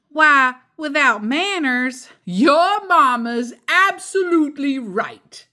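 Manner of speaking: eager dad butting in; Papa Bear voice. Acted. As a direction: bluster in as Papa cutting in.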